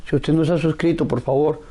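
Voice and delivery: normal talking voice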